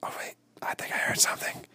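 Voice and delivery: sudden alarmed whisper. Pretend. Hunter hushing after a noise in the woods. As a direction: cut to a whisper, listening.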